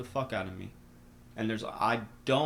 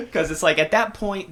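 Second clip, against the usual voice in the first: real laugh, much higher and louder; loud laughter; 10 semitones higher, 11 dB louder than usual. Real, not acted. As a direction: laugh hard through the words. Laughs at werewolf joke.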